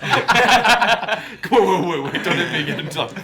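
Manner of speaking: loud, high laughing plea. Real, not acted. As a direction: beg through laughter.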